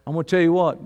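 ordinary talk

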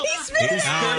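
high laughing outburst. Real, not acted. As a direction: burst out laughing at the spit. Taster spits out raspberry.

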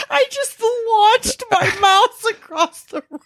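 high shaky laugh after a fright; laughing it off, still shaken. Real, not acted. After the bug scare.

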